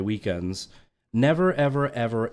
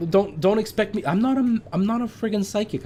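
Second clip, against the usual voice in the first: exasperated rant; 12 semitones higher than usual. Real, not acted. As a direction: vent it, you can't believe him. Ranting at absent coworker.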